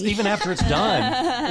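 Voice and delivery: high, slow, laughing words. Pretend.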